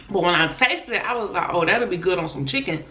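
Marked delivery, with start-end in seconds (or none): none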